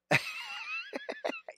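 a sudden high burst of laughter. Real, not acted. Laughing at his own coffee joke.

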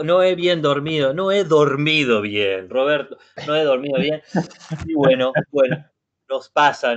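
ordinary delivery

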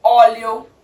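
ਓਲੀਓ said with a round open O, not A; Italian olio with O. Shown as a demonstration. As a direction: start ਓਲੀਓ on a clear O sound. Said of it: "ਇਹਨੂੰ ਕਹਿਣਾ ਓ ਨਾਲ". (ਓਲੀਓ ਓ ਦੀ ਆਵਾਜ਼ ਨਾਲ ਬੋਲੋ)

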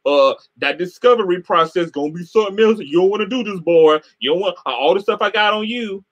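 a light mocking impression of a taunting man. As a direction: sneer it like a smug bully. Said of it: "he was saying".

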